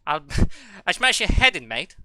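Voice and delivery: playful thug; mock British threat. Streamer threatening in a mock British accent.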